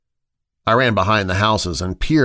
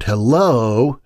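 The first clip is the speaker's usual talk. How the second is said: usual speaking voice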